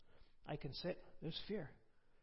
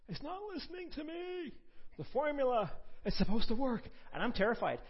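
high, loud rising panic; panicked; 10 semitones higher, 9 dB louder than usual. Retold or imitated. Telling the church how scared he was, loud and high. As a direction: let the fear burst out loud. Retelling terror in the cot.

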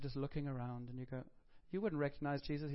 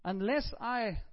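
insistent demand; 6 semitones higher, 6 dB louder, much slower than usual. Pretend. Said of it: "Tell him".

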